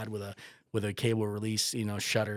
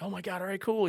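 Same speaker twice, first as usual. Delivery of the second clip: relieved excitement; 10 semitones higher than usual. Retold or imitated. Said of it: "And I'm like".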